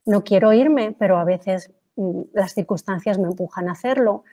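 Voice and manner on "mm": ordinary delivery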